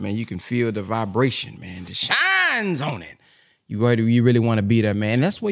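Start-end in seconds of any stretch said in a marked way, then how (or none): 1.9-3.2 slow, higher preacher imitation; a light echo of the minister's roar; imitating the roaring voice. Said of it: "this voice be roaring in person".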